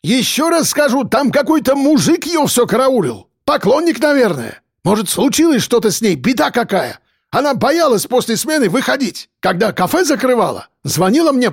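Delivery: high-pitched, agitated complainant; excitable character voice, indignant; indignant Caucasian man. A narrator's reading. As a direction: press the complaint in a high, heated voice. Voices agitated Akbar retelling.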